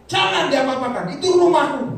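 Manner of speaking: high, forceful imitated protest; acted spirit's protest. Preacher voicing spirit claiming the sword, high loud protest. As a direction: raise pitch and volume, defending your territory.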